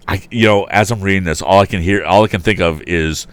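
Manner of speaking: ordinary delivery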